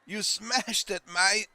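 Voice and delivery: high, loud hammed-up Aussie; terrible Australian accent. Gamer echoing the game's praise, terrible Australian accent. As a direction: ham up a bad Australian accent, pitched high. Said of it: "my terrible Australian".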